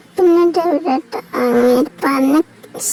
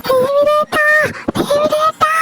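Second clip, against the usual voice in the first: higher wailing call of a name; acted crying; 7 semitones higher, slower than usual. Startled girl crying out for her brother, wailing. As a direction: wail for the brother like a hurt child. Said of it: "കരയാതെ".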